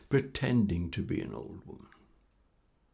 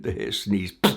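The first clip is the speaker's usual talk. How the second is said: plain reading voice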